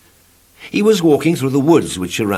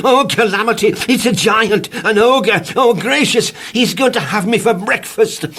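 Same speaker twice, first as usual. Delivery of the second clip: panicky cries; 12 semitones higher, 4 dB louder than usual. Acted. Mr Jelly panics at giant.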